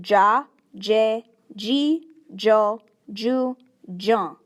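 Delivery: "ja, je, ji, jo, ju, jon" said slowly and clearly, one syllable at a time; Oneida J syllables. Shown as a demonstration. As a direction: spell out each syllable "ja, je, ji, jo, ju, jon" like a teacher. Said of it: "this set will sound like".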